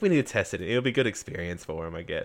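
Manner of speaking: very low, soft resignation; worn-out, half-hearted justification; tired. Acted. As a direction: let the voice sink away wearily. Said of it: "Yazan's so tired".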